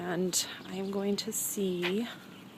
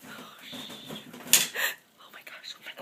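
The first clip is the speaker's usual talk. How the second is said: whispered amazement; whispering. Real, not acted. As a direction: breathe it out in awe. Hushed amazement near bird.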